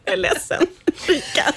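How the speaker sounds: high, giggling through the words; laughing apology. Real, not acted. Host apologising to Marika through laughter.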